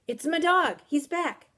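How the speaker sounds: high, loud excited cry; bursting with excitement; overjoyed. A narrator's reading. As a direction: call it out thrilled. Girl jumps up overjoyed.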